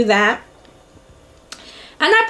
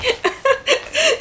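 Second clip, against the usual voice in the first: high burst of laughter; 13 semitones higher than usual. Real, not acted. Laughing at boss's reminder text.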